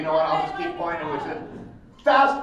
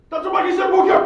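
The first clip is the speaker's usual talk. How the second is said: high, loud video-game battle cry; Ryu; 11 dB louder, faster than usual. Shown as a demonstration. Fighter calling his hurricane kick on request, high shout. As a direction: shout it like a special move. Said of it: "do the hurricane kick as Ryu".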